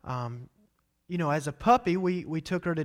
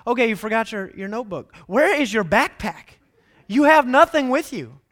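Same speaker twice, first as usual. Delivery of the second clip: higher, louder exasperated teacher; mock fed-up teacher, building louder; scolding teacher; 4 semitones higher, 7 dB louder than usual. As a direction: scold the forgetful kid. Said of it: "students like me".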